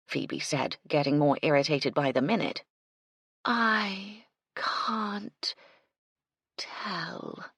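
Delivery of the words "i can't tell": drawn-out whispered monotone; mournful murmur. Acted. Madeline refusing Phoebe, whispered mournful monotone. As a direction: trail off mournfully. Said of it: "murmured, mournful monotone".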